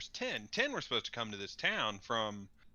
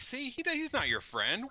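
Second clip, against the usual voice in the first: much higher, wheedling tone; coaxing voice; 13 semitones higher than usual. Acted. Player's character coaxing the prisoner against his friend, high wheedling. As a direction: sweet-talk the prisoner. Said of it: "I'm like".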